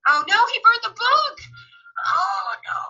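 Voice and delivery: high, wailing dismay; "oh no" wailed high; dismayed outcry. Real, not acted. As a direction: cry out at a disaster on screen. Dismayed reaction to the show.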